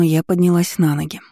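ordinary delivery, nothing marked

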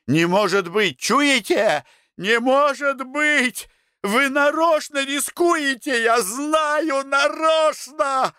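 very high, drawn-out agitated cries; choking hysterical outburst. A narrator's reading. Semyon accuses the director of mocking him, hysterical outburst. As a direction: cry out as if driven past endurance. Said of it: "Он задохнулся и сел на стул".

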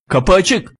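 snappish rebuke. Acted. Driver rebukes passenger seated by the door, snappish. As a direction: tell someone off tersely. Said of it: "diye tersliyor".

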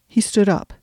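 ordinary delivery

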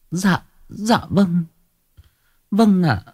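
high, slow, timid yes; meek young woman. A narrator's reading. Woman timidly obeying the shaman, meek and high. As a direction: answer small and humble.

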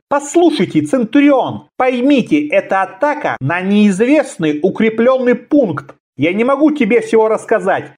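high, earnest pleading; persuading tone. A narrator's reading. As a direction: reason urgently with the officer. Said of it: "убеждающим тоном начал говорить".

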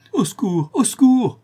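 high, loud scream for rescue; desperate cry for help. A narrator's reading. La Chouette, overpowered in the cellar, screams for help, high and desperate. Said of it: "cria la chouette d'une voix haletante".

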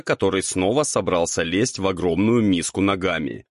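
usual speaking voice

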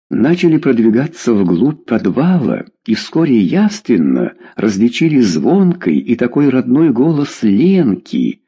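ordinary delivery

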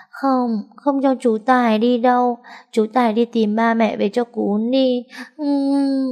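put-on high little girl's voice, begging; a small girl who won't let her uncle leave; pleading child. Little girl begging uncle Tài not to leave, pleading.

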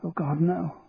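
understated shocked dismay; faintly horrified. A narrator's reading. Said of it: "she said, nearly fainting".

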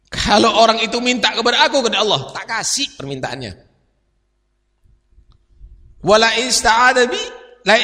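loud, high chanted Arabic quotation; impassioned sermon recitation; preaching at full force. Acted. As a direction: proclaim the hadith to the hall. Quoting hadith in full cry.

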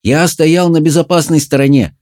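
high-pitched defensive objection; protesting. A narrator's reading. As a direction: protest your innocence. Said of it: "запротестовал Джаред".

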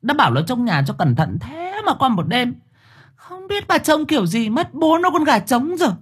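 high, fast angry tirade at the ghost; shrill scolding. A narrator's reading. Angry woman scolding the ghost over her lost hens. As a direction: yell at the ghost like an enraged village woman. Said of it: "nghe tiếng cô Thơm choa choả".

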